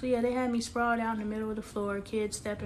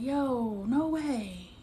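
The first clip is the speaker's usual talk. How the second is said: retold disbelief; 3 semitones higher, much slower than usual. Retells disbelief on hearing the news.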